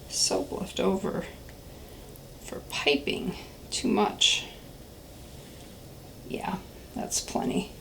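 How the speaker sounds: very soft, half-whispered aside; barely voiced, absorbed in the task; murmuring to self. Real, not acted. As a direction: think aloud under your breath while working. Thinking aloud while pouring.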